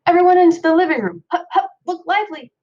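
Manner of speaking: loud, high, fast call to the family; dad's rousing shout. A narrator's reading. Dad loudly hurrying the family into the living room, brisk high shout. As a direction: round everyone up like a drill sergeant, fast and loud. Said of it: "he shouted".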